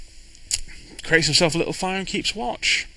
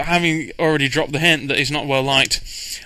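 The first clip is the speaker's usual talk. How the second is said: ordinary talk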